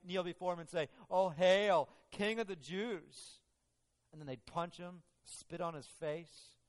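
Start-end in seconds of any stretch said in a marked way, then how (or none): 1.1-3.4 mocking, drawn-out hail to a king; sarcastic mock hail. Soldiers mocking Jesus, sarcastic jeer. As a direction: jeer it slowly like a mock salute. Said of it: "this sarcastic, prejudiced response".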